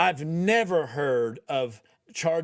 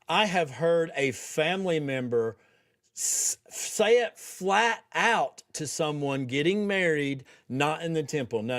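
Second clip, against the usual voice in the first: normal talking voice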